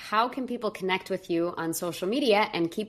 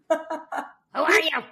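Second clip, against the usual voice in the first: put-on Yiddish accent; 9 semitones higher than usual. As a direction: bark it like a suspicious old Yiddish speaker. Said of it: "some guy in a thick Yiddish accent in Russian".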